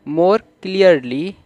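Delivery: each word drawn out slowly for learners; slow classroom read-aloud; slow reading demo. Shown as a demonstration. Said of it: "तो clearly को हम पढ़ते हैं".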